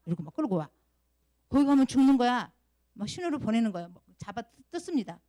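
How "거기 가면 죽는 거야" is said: loud, higher warning call; urgent warning. Acted. Teacher acts out warning the blind man off the cliff, urgent shout.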